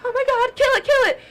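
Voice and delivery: high, loud mock hysteria; imitated panicked scream. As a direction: order it killed in a pretend scream. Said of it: "She starts screaming for my dad".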